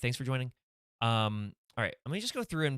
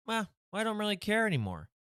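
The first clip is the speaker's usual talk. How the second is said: high, careless shrug of a line; indifferent magician; 10 semitones higher, faster than usual. Acted. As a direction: toss it off, high and unbothered.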